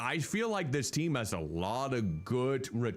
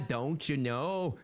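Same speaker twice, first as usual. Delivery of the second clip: slow mock Midwestern "don't you know"; Midwestern accent; slower than usual. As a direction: tease it out in a Midwestern accent, slowly. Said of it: "She has that Midwestern accent".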